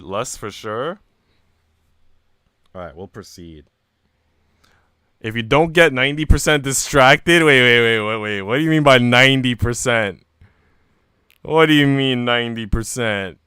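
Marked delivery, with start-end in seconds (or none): 5.2-13.4 loud, incredulous protest at chat; real loud disbelief; loud outburst. Real, not acted. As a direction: push back loud and fast at chat. Reacting to chat comment.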